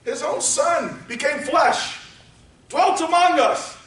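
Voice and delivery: rising, shouted sermon climax; preaching at full force. Acted.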